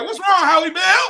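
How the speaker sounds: high, loud mock concern; teasing friends. Comic imitating friends at breakfast, high loud mock concern. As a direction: call it out high with a rising end. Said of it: "They was like".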